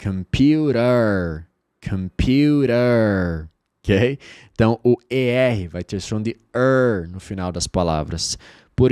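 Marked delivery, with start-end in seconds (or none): 0.0-3.5 Computer stretched very slowly; slow model word. Shown as a demonstration. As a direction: Computer drilled, syllable by syllable. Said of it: "Computador se diz".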